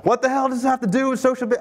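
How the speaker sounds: high, loud, fast shout; hammed-up yell from the audience; mock yelled heckle. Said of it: "could have just yelled out".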